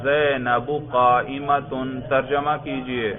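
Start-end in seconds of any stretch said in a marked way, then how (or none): 0.0-1.7 measured زینب قائمۃ with both case endings sounded; full-sentence recitation. Shown as a demonstration. Teacher repeats the student's sentence, slow recitation of زینب قائمۃ with endings. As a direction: read زینب قائمۃ slowly as "Zainabu qaa-imatun". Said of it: "پورے جملے کو روانی سے پڑھ دو".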